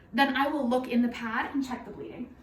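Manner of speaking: normal talking voice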